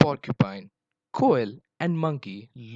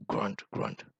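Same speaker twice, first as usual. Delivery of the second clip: low, voiceless grunted words; boar grunts; 3 semitones lower, 5 dB quieter than usual. A narrator's reading. Wild Boar joins the talk, low soft grunting. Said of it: "said Wild Boar".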